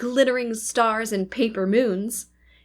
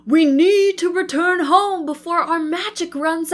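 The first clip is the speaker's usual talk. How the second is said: urgent, higher and louder exclaiming; teddy captain exclaiming in alarm; exclaimed; 7 semitones higher, 7 dB louder than usual. A narrator's reading. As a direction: raise the alarm to get the crew home. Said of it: "Captain Teddy exclaimed".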